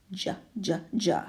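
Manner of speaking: breathy whispered j, j, j like a chuckle; jolly laugh. Shown as a demonstration. Teacher shows the joker's laugh as breathy chuckle. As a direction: laugh out the j softly. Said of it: "That's his jolly laugh".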